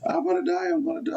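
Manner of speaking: high-pitched mock panic wail; imitating panicked cries. Storyteller mimics girl on a bad trip, high panicked cries. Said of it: "crying and screaming".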